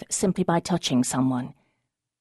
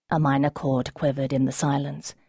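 ordinary delivery

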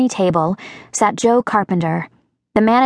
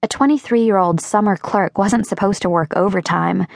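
usual speaking voice

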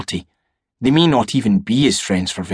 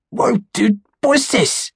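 high startled whoa after a choke; surprised teen, voice jumping up; shocked splutter; 12 semitones higher than usual. Acted. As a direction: react like the drink just burned you. Jason splutters at vodka.

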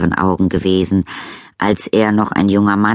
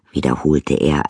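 ordinary delivery, nothing marked

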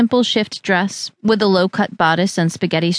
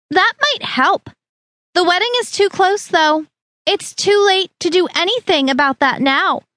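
Harriet's voice; 8 semitones higher than usual. A narrator's reading. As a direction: pronounce it too late in a high, prim tone.

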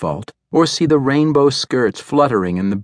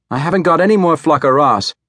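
high, bright-voiced young newsgirl; chirpy kiosk girl; 6 semitones higher, 4 dB louder, faster than usual. A narrator's reading. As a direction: call it out high and quick. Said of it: "announced the soprano".

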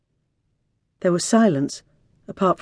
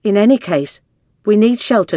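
louder, higher, firm insistence; insistent demand; 5 semitones higher, 7 dB louder than usual. A narrator's reading. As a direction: press the demand on the doctor. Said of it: "spoke up again".

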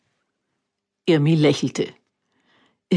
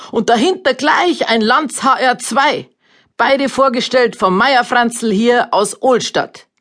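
announcer voice; 6 semitones higher, 3 dB louder than usual. A narrator's reading. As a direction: call it out like a fairground announcer. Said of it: "rief der Ansager".

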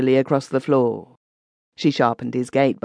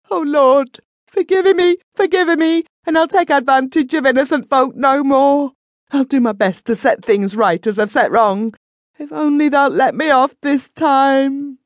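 terrified pleading; 12 semitones higher, 4 dB louder than usual. A narrator's reading. Praying in terror while dragged.

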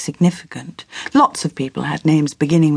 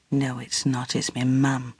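grim thought; 5 semitones lower, 9 dB quieter than usual. Acted. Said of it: "but thought grimly".

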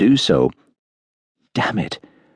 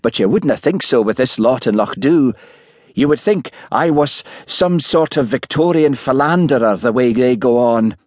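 put-on lightly aggrieved Highland accent, pitch raised; aggrieved Highland policeman; 6 semitones higher than usual. A narrator's reading. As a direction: complain in a mild Highland accent.

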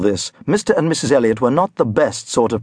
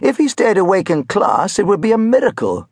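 higher, exasperated schoolmaster's voice; pious teacher's raised grumble; complaining religion teacher; 8 semitones higher than usual. A narrator's reading. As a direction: complain to heaven about the boy. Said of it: "the religion teacher had complained".

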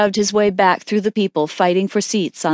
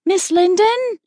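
calling out; 13 semitones higher than usual. A narrator's reading. Isabel calling for vanished companion, calling out. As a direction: call for someone out of sight, pitched up. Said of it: "Isabel called out".

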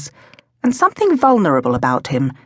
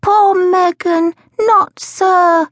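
put-on very high, slow child voice; childish sorrow, drawn out high; sad little boy; 12 semitones higher, 4 dB louder, much slower than usual. A narrator's reading. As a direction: pity her as a sad toddler. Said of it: "said Toby sadly".

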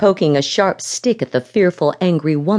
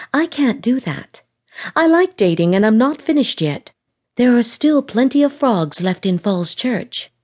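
defiant; 6 semitones higher than usual. A narrator's reading. Lauren defies Jim.

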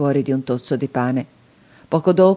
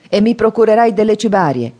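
higher, louder convict threat; angry threat; 5 semitones higher, 5 dB louder than usual. A narrator's reading. Convict shaking the boy, demanding a file, angrily louder. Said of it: "mi scuoteva rabbiosamente".

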